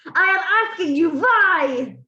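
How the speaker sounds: high, loud, slow scornful screech; the Grand High Witch berating her audience, yelling in fury; furious witch yelling. A narrator's reading. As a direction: scold the witches like vermin. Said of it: "she screamed".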